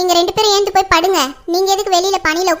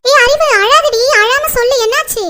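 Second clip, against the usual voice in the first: high, urgent questioning; alarmed concern; 5 semitones higher, 5 dB louder than usual. Acted. Friend asks a crying woman what happened, alarmed. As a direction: call out in alarm, pitched high.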